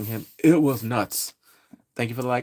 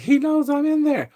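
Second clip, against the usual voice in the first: high, louder worried blurt; high alarmed voice; 14 semitones higher, 5 dB louder than usual. Retold or imitated. Halloran realizing Pennywise senses him, high alarmed blurt. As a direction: sound caught out. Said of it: "He's like".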